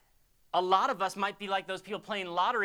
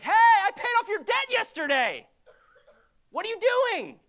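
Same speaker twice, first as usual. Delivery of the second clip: much higher and louder, half laughing in exasperation; incredulous, laughing yell; shocked disbelief; 13 semitones higher, 9 dB louder than usual. Retold or imitated. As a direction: call out a friend you just bailed out. Confronting debtor at casino.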